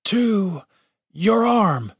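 high, strained words broken by pauses; pained, halting. A narrator's reading. Injured guard asking the healer, in pain. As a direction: push each word out through pain. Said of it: "winced in pain and then continued".

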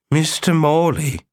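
put-on slow, high old lady voice; a frail old woman greeting; elderly woman voice. A narrator's reading.

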